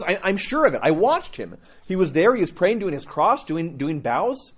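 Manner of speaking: high-pitched imitated insistence; insistent. Parishioner assuring the priest he saw someone, insistent.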